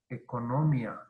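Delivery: "Economía" stressed on NO, ecoNÓmia, falling ending; wrong stress. Shown as a demonstration. Teacher repeating the wrong form, stress shifted to NO in "Economía". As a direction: hit NO in "Economía", let mia slide down as one syllable. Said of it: "si fuera hiato, sería economía".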